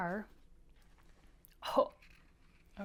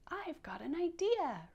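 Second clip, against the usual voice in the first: higher "I've got an idea"; excited idea; 8 semitones higher than usual. Shown as a demonstration. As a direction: say it like a thrilled rabbit. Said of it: "you're so excited and say".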